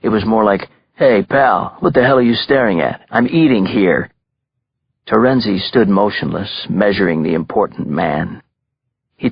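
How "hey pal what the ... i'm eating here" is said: put-on Brooklyn tough-guy accent, fast and pushy. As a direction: snap at him in Brooklyn street talk. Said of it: "His raised on the streets of Brooklyn tone".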